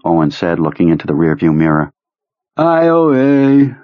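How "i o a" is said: impaired speech. Acted. Duddits' damaged speech, shivering.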